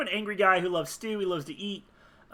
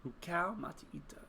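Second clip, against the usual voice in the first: soft, voiceless whisper; whispered to self; 9 dB quieter than usual. Real, not acted. Player mulling a new character name, whispered.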